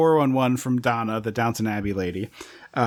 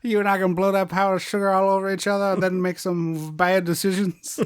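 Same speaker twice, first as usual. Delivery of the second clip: mock New Orleans accent; 6 semitones higher than usual. Heroine propositioning Johnny, mock New Orleans accent. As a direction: ham up a flirty Southern accent.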